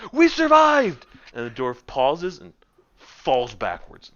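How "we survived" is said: high, loud triumphant shout; jubilant Norin. Acted. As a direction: cheer it while slapping a shoulder. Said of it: "slaps him on the shoulder".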